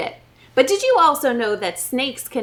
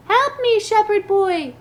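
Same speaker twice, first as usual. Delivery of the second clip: high, pleading snake voice; trapped snake pleading; snake crying; 9 semitones higher, 4 dB louder than usual. Acted. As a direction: beg the shepherd for help. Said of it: "cried the snake".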